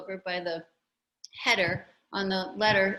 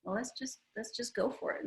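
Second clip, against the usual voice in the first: soft resolve; 4 semitones higher, 6 dB quieter than usual. Retold or imitated. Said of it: "I'm like".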